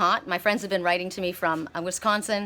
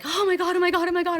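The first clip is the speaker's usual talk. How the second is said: high, loud excited squeal of oh my God; freaking out; 10 semitones higher, 4 dB louder than usual. Retold or imitated. Falcon above her head, freaking out with high excitement. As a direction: gush like you can't believe your eyes. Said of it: "I was freaking out".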